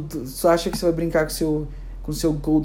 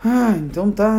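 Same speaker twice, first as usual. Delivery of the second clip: higher, louder huffy concession; sulky tiger Brad; 5 semitones higher, 5 dB louder than usual. Acted. Tiger told to release the child, acted huffy reply. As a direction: give in with an exaggerated huff. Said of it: "Aí o Brad vai falar assim".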